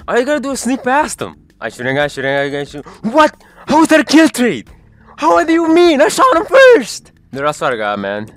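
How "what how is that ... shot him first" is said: high, loud furious gamer protest; raging gamer. Acted. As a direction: yell it like you were robbed. Raging at a kill trade.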